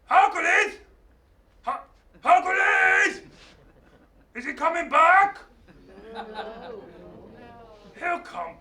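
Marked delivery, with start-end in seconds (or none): 0.0-5.4 high, loud calls; calling after him. Acted. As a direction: shout after him as he leaves. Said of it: "And Atlas watches him and goes".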